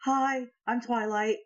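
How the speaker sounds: high, slow little hello; friendly Twilight. Acted. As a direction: pipe up from behind someone. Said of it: "I peek out from behind".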